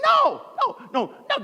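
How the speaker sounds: hammed-up panicky refusal; adamant refusal. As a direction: burst out high, then ease down. Acted refusal to repeated request.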